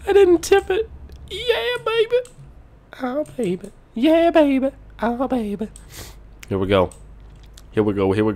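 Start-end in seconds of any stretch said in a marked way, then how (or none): 0.1-5.7 very high falsetto; silly high-pitched relief; squeaky celebration. Pretend. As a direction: cheer in a tiny squeaky voice. Celebrates not tipping the truck.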